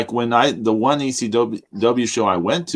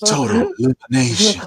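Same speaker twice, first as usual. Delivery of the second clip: higher-pitched mock DJ announcer; 4 semitones higher than usual. Friend mimicking James's DJ voice on a move name, playful DJ voice. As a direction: put on an exaggerated DJ voice. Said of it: "like your D J voice".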